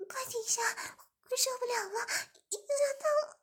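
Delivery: high, pleading cry with a breaking voice; high crying plea to stop; begging for mercy. A narrator's reading. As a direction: beg him to stop, almost sobbing. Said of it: "马上求饶道".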